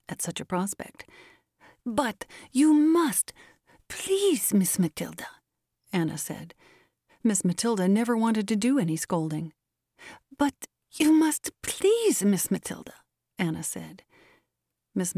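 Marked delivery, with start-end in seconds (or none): 1.8-5.3 high, very slow begging; insistent pleading. A narrator's reading. Anna presses her unwilling mistress, insistent pleading. 10.4-12.9 high, very slow begging; insistent pleading. A narrator's reading. Anna presses her unwilling mistress, insistent pleading.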